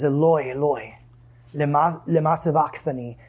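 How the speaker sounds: ordinary talk, nothing marked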